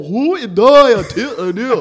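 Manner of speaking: loud, slow, high Scottish gibberish; exaggerated Scottish holler, stretched out; mock Scottish accent. Showing friends how Scottish people talk, loud mock Scottish. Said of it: "Scottish people got that weird".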